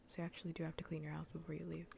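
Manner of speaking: usual speaking voice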